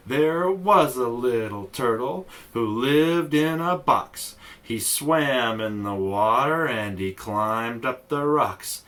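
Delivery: slow, rhythmic chant with lifted line ends; children's rhyme recital. Acted. Teacher says the turtle rhyme for kids, slow and singsong. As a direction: recite it to a steady beat, lilting.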